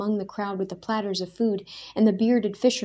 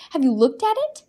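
high, louder pressing question; demanding; 9 semitones higher, 6 dB louder, faster than usual. A narrator's reading. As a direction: push him to admit it. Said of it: "Daniel demanded".

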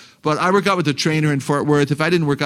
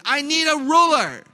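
imitated shouted outburst; 10 semitones higher than usual. Boy slamming the table at his mom, high mock shout. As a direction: wail it like an exasperated boy. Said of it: "and he shouted".

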